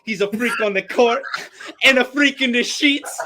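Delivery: high, put-on voice rising to a squeak; Giannis quote. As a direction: quote him with rising glee. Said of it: "He goes".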